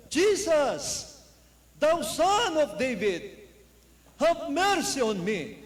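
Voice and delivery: high, loud, slow plea for mercy; pleading cry. Retold or imitated. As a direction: cry out desperately, stretching each word. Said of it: "Nagsimula siyang sumigaw ng malakas". (mabagal at malakas na pagsusumamo)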